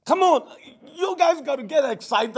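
usual speaking voice

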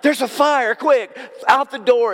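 bursting out the office door as if fleeing a fire; mock alarm. Preacher acting out rushing out the door, mock alarm. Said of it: "it's like a, like".

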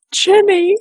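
high, stricken gasp of the name; shocked cry. Retold or imitated. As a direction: cry out in sudden horror.

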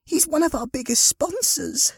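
very high-pitched, dismayed woman's voice; dismayed exclamation. Acted. Woman telling Harry about the sponsor, exclaiming. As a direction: exclaim it in high, upset tones. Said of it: "she exclaimed".

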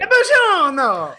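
slow, loud hammy buongiorno; fake Italian accent. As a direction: drag it out like a stage Italian. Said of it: "this fakey Italian accent".

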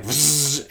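a mock chainsaw buzz for a comedy act; chainsaw noise. Imitating a chainsaw in her act.

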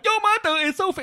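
high-pitched go at a Singapore accent; mock Singapore-accented patter, cut short; attempted Singapore accent. Shown as a demonstration. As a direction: try out Singaporean speech, pitched right up.

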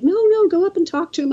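high, quick imitation of mom; urging mom. Mom pushing her starstruck kid toward Stan Lee, urging.

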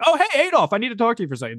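high-pitched, breezy "oh hey" call; mock casual hail. Skipping down the street, hailing Adolf, mock casual hail. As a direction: pretend to flag someone down casually.

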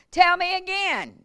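high, loud, drawn-out demand; insistent demand. Retold or imitated. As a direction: press her to repeat it. Doctor demanding the story again.

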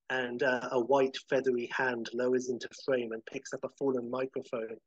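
ordinary delivery

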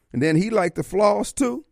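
usual speaking voice

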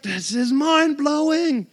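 slow, wondering; awed. Retold or imitated. Son amazed by God, slow and wondering. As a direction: draw it out in awe. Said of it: "He's like".

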